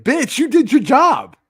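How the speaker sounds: high, loud disbelief at the decoy; exasperated retort. Retold or imitated. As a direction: scold her like she's being ridiculous. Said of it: "And I'm always like".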